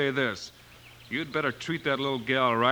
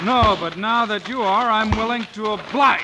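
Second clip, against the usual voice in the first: higher, louder, squaring up; defiant challenge; 7 semitones higher, 5 dB louder than usual. Acted. Marshal squaring up to the groom, loud and defiant. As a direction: call his bluff out loud. Fistfight challenge between Bill and Dalton.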